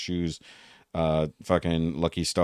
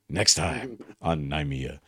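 mock trailer announcer; 3 semitones lower, 4 dB quieter, much slower than usual. Mock show-trailer sign-off.

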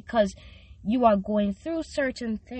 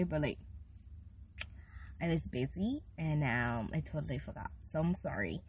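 much softer, lower apology; sheepish; 7 semitones lower, 12 dB quieter than usual. Real, not acted. Host sheepishly apologizing to listeners for missing her birthday. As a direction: sheepishly admit forgetting.

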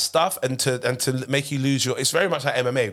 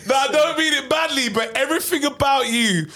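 high, excited protest; real high-pitched, animated protest; worked-up insistence; 12 semitones higher than usual. Real, not acted. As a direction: insist over the laughter, pitch right up. Protesting over friends' laughter and apology.

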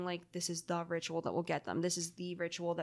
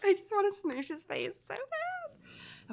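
gushing; 12 semitones higher, slower than usual. Real, not acted. Gushing over the cute cat.

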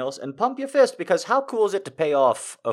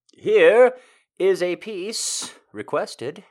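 slow build; 8 semitones higher, 8 dB louder, much slower than usual. Real, not acted. Dramatic announcement.

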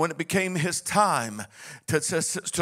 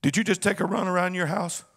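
ordinary talk

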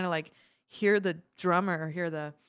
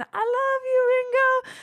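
very high, loud mock screaming fan; imitated fan yell; 6 dB louder, much slower than usual. Concert fan yelling to [name] on stage, imitated yell. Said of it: "when you guys yell at me".

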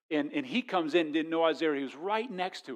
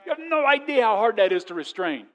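louder, higher, exasperated insistence; exasperated men; 10 semitones higher, 9 dB louder than usual. Retold or imitated. Preacher voicing men explaining restraint, louder and exasperated. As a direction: press it like a frustrated man holding back. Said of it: "Men are like".

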